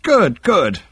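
high-pitched, pleased approval; beaming psychiatrist. A narrator's reading. Psychiatrist pleased by the Vogon's report, beaming. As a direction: say it with an audible smile. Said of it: "beamed Halfront".

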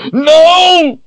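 high, loud anguished cry; shriek. A narrator's reading. Richard refuses to kill her, anguished shriek. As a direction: shriek it out at full pitch. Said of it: "he shrieked".

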